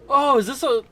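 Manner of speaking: sudden high thrilled oh; delighted discovery, voice jumps up; excited. Real, not acted. Spots something new in game.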